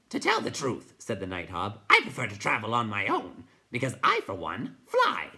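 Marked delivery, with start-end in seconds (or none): 0.1-0.9 high hooting creature voice. A narrator's reading. Night Hob voiced. 1.9-5.3 high hooting creature voice. A narrator's reading. Night Hob voiced.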